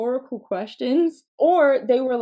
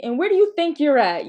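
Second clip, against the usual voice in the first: loud, higher mock-stern challenge; challenging mom; 6 semitones higher, 7 dB louder, faster than usual. Mom to child running in the house, loud rhetorical challenge. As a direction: demand it like a mother catching mischief. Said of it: "my mom would say to me".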